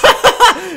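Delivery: short, high, loud laugh; sudden loud giggling fit; burst of laughter. Real, not acted. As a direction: crack up mid-sentence. Laughs as monster appears.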